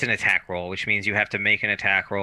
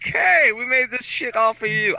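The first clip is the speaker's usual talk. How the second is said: mock game maker voice; 13 semitones higher, 5 dB louder than usual.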